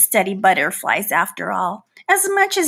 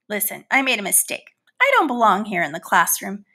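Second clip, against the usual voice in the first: usual speaking voice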